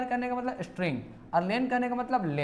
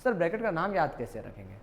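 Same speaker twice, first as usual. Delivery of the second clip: normal talking voice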